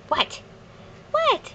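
ordinary delivery

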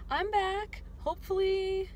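cheerful comeback announcement; excited. Real, not acted. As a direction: announce you're back with a burst of glee. Happy return to vlogging.

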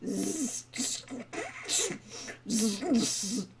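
put-on soft drawn-out snores of a sleeping old dwarf; dozing dwarf snoring. A narrator's reading. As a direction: snore gently through the z's. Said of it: "the old dwarf snoozed on".

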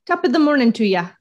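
bad Irish accent. Hinting at Ireland for the guess, in a bad Irish accent. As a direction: throw out a cheesy Irish brogue. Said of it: "my accent sucks that bad".

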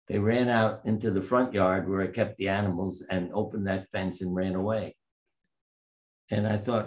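usual speaking voice

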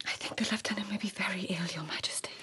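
hushed whisper to the Emperor. Acted. Lady whispers her worry to the Emperor. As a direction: keep it barely breathed, confidential.

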